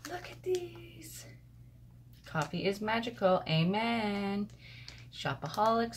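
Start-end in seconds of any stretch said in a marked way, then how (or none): 0.0-1.3 hushed, high, drawn-out awe; gasp, then softly marvel in a slow high whisper; awed gasp. Real, not acted. Gasps on seeing a gift.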